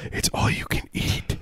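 breathy whisper, slow; conspiratorial whisper. Retold or imitated. Waiter whispering to a diner, hushed and slow. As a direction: let the secret slip out under your breath.

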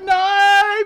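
high, loud wailed protest; drawn-out, comically distressed cry; mock wail. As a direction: imitate your own wailing, hammed up. Retells tearful protest at cancelled holiday.

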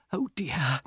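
soft, breathless whisper of dismay; shocked whisper. Acted. Shocked aside mid alarm.

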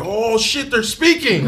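high-pitched excited exclamation; amazed outburst. Retold or imitated. Gamer recalling the first voiced games, acting out amazement.

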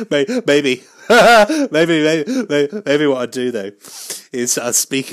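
loud, high laugh breaking up the words; giggly false starts, tickled by your own idea; real giggling. Real, not acted. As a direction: crack up and stumble while getting it out.

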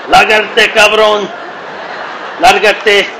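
loud, high Spanish "Lárgate, cabrón"; mock threatening yell. Shown as a demonstration. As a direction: bark "Lárgate" like a real threat. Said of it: "in Spanish".

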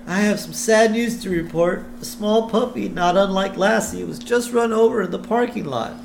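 high-pitched public announcer voice; announcer with sad news. Acted. Announcer telling an audience about a puppy, in announcement voice. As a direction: deliver a solemn announcement, pitched well up. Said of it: "we hear an announcement".

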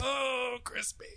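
high laughing burst. Real, not acted. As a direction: crack up mid-word.

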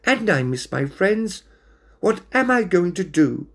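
small scared voice; scared little cat. Acted.

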